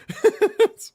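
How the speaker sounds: sudden high burst of laughter; real high laugh. Real, not acted. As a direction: let a high laugh escape. Laughs at floating apartment joke.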